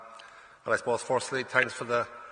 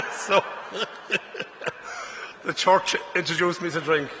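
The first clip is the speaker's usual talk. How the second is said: laughing; 5 semitones higher, 5 dB louder than usual. Real, not acted. Confessing altar wine drinking.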